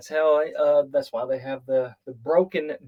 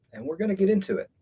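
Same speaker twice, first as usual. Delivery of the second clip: ordinary talk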